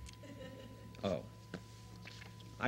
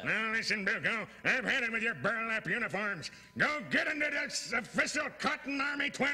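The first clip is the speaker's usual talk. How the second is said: stern colonel; 9 semitones higher than usual. Acted. Colonel scolding Bilko.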